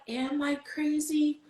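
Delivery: high, drawn-out questioning of oneself; self-doubting. Retold or imitated. Asking God if she's crazy, high doubtful plea.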